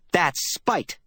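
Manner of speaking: put-on a furious girl crying out an accusation; heated cry. A narrator's reading. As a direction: fling the word at him. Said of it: "the girl cried".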